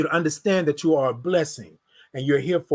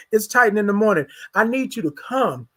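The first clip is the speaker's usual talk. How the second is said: ordinary talk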